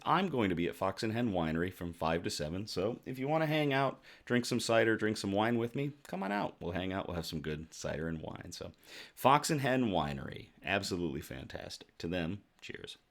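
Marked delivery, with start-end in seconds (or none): none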